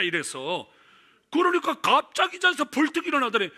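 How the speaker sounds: ordinary talk, nothing marked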